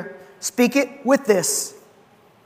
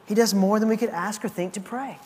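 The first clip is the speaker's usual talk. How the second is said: ordinary talk, nothing marked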